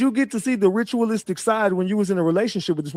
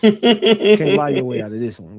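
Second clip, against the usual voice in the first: laughing; 4 semitones lower, 5 dB louder than usual. Real, not acted. Laughing while teasing.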